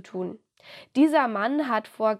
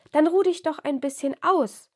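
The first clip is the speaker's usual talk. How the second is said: put-on higher, louder little boy voice; child voice; 6 semitones higher, 4 dB louder than usual. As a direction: speak up like an eager small boy. Child Anton speaks.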